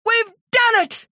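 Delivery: high-pitched yell of triumph; triumphant yell. A narrator's reading. Author tells Terry the book is finished, triumphant yell. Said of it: "I yelled".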